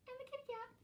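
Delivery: sudden squeaky high "and the kitty cat"; cutesy high. Acted. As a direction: squeak it like a baby talking to a kitten. Cute voice for the cat.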